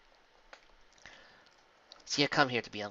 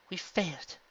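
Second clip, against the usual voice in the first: devastated; 6 semitones higher, 6 dB quieter than usual. Acted. Luna devastated after failure.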